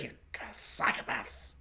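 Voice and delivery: whispered, drawn-out accusation; hushed insult. Acted. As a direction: drop to a whisper. Insult capping the rant.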